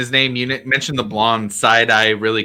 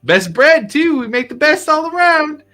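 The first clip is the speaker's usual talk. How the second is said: much higher, louder, cheerful shout; Michonne's voice; 6 dB louder than usual. Acted. Bartender Michonne greets the new arrivals, loud and high. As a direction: boom out a jolly hello from the doorway. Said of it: "He goes".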